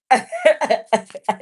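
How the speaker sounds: loud high laugh after a defiant line; burst of laughter. Real, not acted. After telling commenters off, a loud laugh.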